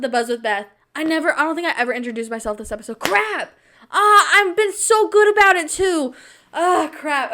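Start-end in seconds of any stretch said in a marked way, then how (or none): 3.0-7.3 high, loud exasperated cry; frustrated outburst. Real, not acted. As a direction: kick yourself out loud. Realizes forgot intro.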